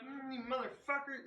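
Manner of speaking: high-pitched annoyed cursing; cussing them out. Acted. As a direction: cuss at them as you approach. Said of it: "cussing them out as I go".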